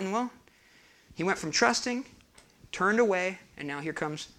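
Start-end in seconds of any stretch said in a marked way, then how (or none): none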